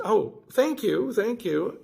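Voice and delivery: drawn-out delighted gratitude; gushing thanks. Retold or imitated. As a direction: thank him like he saved your day. Stranger pays cart coin for her.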